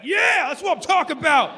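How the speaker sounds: high, loud whooping cheer; cheering crowd. Retold or imitated. Fans cheering at the stage, loud high whoop. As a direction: cheer like a thrilled fan.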